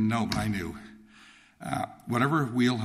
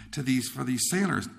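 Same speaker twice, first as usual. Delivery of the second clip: normal talking voice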